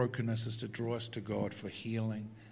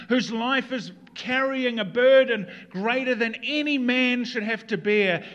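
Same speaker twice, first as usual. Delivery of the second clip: raised, high-pitched sermon delivery; impassioned preaching; 10 semitones higher, 10 dB louder than usual. Real, not acted. Pastor describes the thief's agony, impassioned preaching. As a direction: drive each phrase home at full force.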